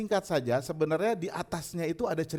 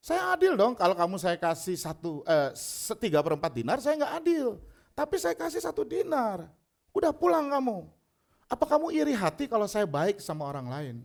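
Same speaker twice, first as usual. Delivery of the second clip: high-pitched indignant owner; affronted retort, pitched up then easing; acted self-defence; 4 semitones higher than usual. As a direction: insist you were fair, then dismiss him. Owner defends fairness, dismisses worker.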